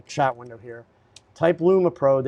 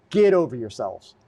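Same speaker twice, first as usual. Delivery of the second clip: normal talking voice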